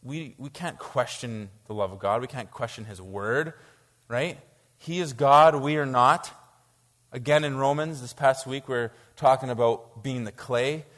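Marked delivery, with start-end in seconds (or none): 4.8-6.3 louder, weighty declaration; declared firmly. Real, not acted. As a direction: land each word with weight. Emphatic declaration.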